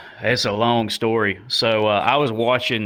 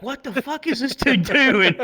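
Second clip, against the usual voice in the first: baffled disbelief; 13 semitones higher than usual. Retold or imitated. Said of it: "And I'm like".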